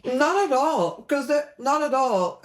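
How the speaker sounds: insistent double denial; emphatic denial. Real, not acted. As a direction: push back on "Not at all", pitch up.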